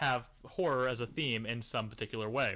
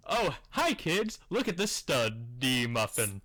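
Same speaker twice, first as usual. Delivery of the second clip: mock kids' host; 3 dB louder, slower than usual. Host pretending to show kids the title, mock-cheery. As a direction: pitch it to children with mock innocence. Mocking a pitch to children.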